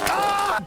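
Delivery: high-pitched yelp; startled scream. Real, not acted. Player caught out mid-game, startled scream. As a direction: scream out in sudden alarm. Startled yell during gameplay.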